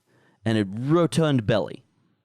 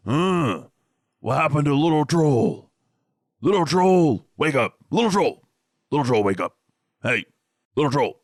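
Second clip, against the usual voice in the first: loud, higher shouting for Little Troll; big troll calling out; 5 semitones higher, 4 dB louder than usual. Acted. Big troll shouting for the fallen Little Troll, loud calling troll voice. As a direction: bellow after the missing little troll. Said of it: "deep voice says".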